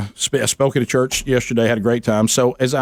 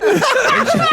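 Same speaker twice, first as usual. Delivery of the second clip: high laugh. Real, not acted. Laughing at the prank.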